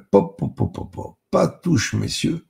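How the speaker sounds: high, loud stop-right-there; brisk warning off. A narrator's reading. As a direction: cut them off with high, forceful pops. Fends hands off the tart. (mise en garde vive, voix aiguë et forte)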